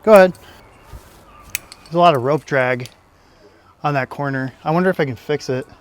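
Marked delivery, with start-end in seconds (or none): 0.0-0.3 raised voice to the belayer; calling down. Real, not acted. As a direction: call down from the wall.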